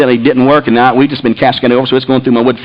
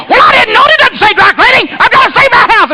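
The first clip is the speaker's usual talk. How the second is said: very high, exasperated protest; yelled excuse. Retold or imitated. Husband defending himself to wife, exasperated yelling. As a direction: imitate a husband hollering back.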